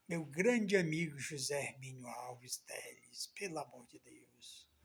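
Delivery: whispered through tears, fading away; barely voiced, choked up over his late friend; mayor in tears whispering about his late friend; tearful whisper. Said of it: "essas lágrimas correndo dos meus olhos".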